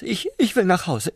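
high, hurried and stammering; urgent stammer. Acted. Prawn begging the slug for help, hurried high plea. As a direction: blurt it out in a rush.

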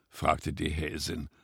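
ordinary delivery

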